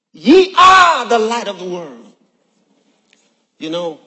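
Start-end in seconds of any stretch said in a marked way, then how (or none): 0.1-2.1 loud powerful proclamation. Real, not acted. Preacher proclaiming the verse with power to the church. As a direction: ring it out with power. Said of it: "He said it with power".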